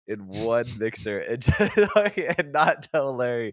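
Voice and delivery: words squeezed out through laughter, high and slow; can barely get it out for laughing; giggling through the punchline. Real, not acted. Laughing while telling the prank plan.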